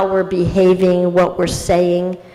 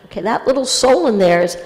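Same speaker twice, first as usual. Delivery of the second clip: normal talking voice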